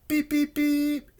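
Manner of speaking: slow, high robot beeps; beeping. A narrator's reading. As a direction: beep like a machine, high and drawn out. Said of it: "beeped Robot".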